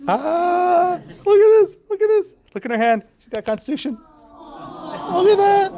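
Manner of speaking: high, loud, gushing delight. Real, not acted. Professor to class, spotting a child holding a Constitution, high delighted gush. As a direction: gush over the little one with the Constitution.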